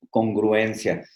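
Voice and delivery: ordinary talk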